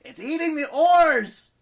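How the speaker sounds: slow, high, loud panicked cry; yelling. Acted. Leo warning the crew, high slow yell. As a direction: drag out the alarm at full volume. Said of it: "Leo yelled".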